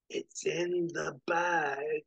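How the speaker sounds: slow, drawn-out wheeze of a dying man; soft dying wheeze. A narrator's reading. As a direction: wheeze it out slowly with your last breath. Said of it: "he softly manages to wheeze".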